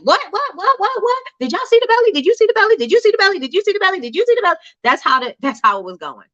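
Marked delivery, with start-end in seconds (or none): 0.0-4.6 hyped-up fan. Retold or imitated. Fans online after the performance, frantically excited and high-pitched. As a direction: squeal at friends who must have seen it.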